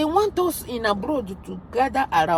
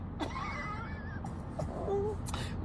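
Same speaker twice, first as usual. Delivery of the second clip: high laugh; 10 semitones higher, 14 dB quieter than usual. Real, not acted. Vlogger laughing at her own slip on tweeting, high laugh. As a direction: burst out laughing at herself.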